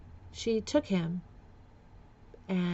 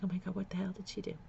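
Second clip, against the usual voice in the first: softer and fast inner panic; quick, hushed run of frightened guesses; racing thoughts; 9 dB quieter than usual. Retold or imitated. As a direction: rattle off the fears under your breath. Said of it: "I'm just like".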